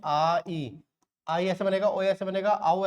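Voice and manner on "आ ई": "आ, ई" as two separate vowel sounds; split diphthong. Shown as a demonstration. Teacher showing the two sounds inside आई, "आ, ई" said separately. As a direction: pull the glide apart into "आ", then "ई". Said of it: "आई में दो sound आ रहे हैं".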